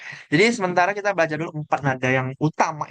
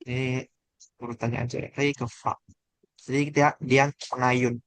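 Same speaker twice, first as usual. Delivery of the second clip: ordinary talk, nothing marked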